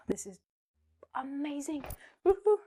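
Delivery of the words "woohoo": excited cheer. Real, not acted. Celebrating a rule.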